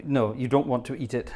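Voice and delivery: ordinary talk, nothing marked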